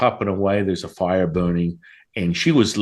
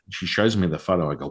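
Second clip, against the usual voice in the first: ordinary delivery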